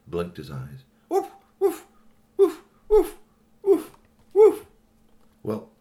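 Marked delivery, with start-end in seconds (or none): 1.1-4.7 robot dog barking. A narrator's reading. Robot dog barks for help.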